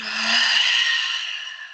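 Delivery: long breathy exhaling sigh; audible sigh. Shown as a demonstration. Teacher modelling the out-breath for the class, long sigh. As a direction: sigh the air out slowly. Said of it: "sigh it out".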